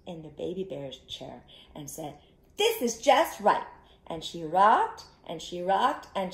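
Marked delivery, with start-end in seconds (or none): 2.6-3.7 higher, louder satisfied line; high happy just right; pleased Goldilocks. Acted. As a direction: sound delighted. Goldilocks voiced in story.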